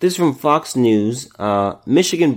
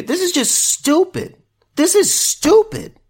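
much higher, disbelieving whine; exasperated; 14 semitones higher than usual. Real, not acted. Reacting to the fire challenge story, high exasperated disbelief. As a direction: stretch out the exasperation.

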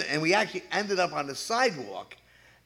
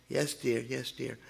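meek wife reply; 8 dB quieter than usual. Retold or imitated. Wife meekly answering husband.

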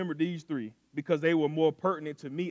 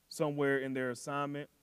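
ordinary talk, nothing marked

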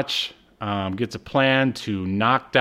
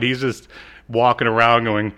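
ordinary talk, nothing marked

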